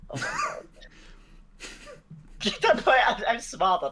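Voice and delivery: high laughing squeal. Real, not acted. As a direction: burst out laughing.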